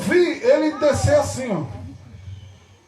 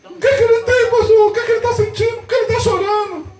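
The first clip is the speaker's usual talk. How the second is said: high, hurried mock alarm; startled mother; 9 semitones higher, 4 dB louder, faster than usual. Mother asks the pastor about her crying son, alarmed. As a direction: imitate a frightened mother, pitched right up. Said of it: "a mãe dele se assustou".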